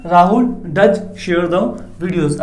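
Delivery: slow, drawn-out words with stress on does; slow dictation. Shown as a demonstration. Teacher dictating the emphatic sentence to students. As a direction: let does stand out slowly. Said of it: "पक्का?".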